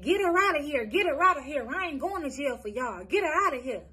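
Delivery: put-on aunt ordering the child removed, loud and angry. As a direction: bark it out like the panicked aunt. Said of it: "The aunt said".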